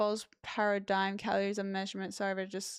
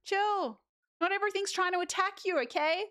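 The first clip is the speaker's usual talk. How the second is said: immune system told off; 9 semitones higher than usual. Retold or imitated. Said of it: "It's like".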